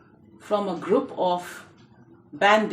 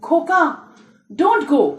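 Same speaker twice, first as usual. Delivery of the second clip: higher, louder pleading, a touch of fear; trembling plea; 7 semitones higher, 5 dB louder, faster than usual. A narrator's reading. Frightened mother begging her son to stay, trembling plea. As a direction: plead as if frightened for your child. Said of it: "all trembling".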